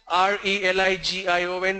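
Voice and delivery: usual speaking voice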